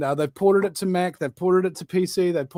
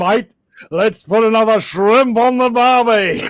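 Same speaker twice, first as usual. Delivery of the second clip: loud, high, hammed-up Australian twang; mock Australian accent; 6 semitones higher, 7 dB louder than usual. Quoting Dumb and Dumber to the co-host, exaggerated mock Australian accent. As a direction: belt out a cartoonish Aussie accent. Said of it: "a lovely accent you have there".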